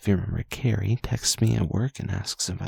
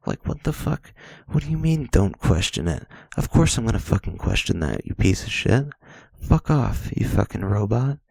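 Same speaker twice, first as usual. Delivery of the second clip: loud angry rant at the chatbot; swearing tirade; 5 dB louder than usual. Real, not acted. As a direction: yell curses at the screen.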